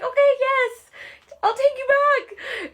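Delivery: very high, breathy-light swoon, slow on the second line; mock lovestruck pushover voice; swooning giver-in. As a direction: melt and give in to the ex. Giving in to the ex.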